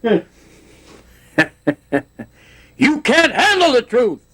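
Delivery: high, slowed movie-line imitation; Tom Cruise impression. Doing a movie star's line for the interviewer, high and slow. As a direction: bark the famous courtroom line as a film star. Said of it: "Tom Cruise".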